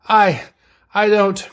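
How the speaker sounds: halting, high and strained; struggling to speak. A narrator's reading. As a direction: barely get the words out, stopping after the first. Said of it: "was all Sulu could get out".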